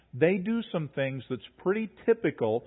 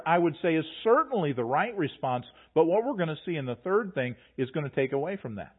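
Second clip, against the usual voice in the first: ordinary delivery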